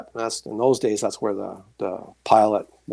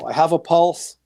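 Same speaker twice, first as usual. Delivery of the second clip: lightly imitated woman, raised pitch; woman calling out; 5 semitones higher, 4 dB louder than usual. Woman in the hospital announces a pulse, imitated call. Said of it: "a woman's voice go".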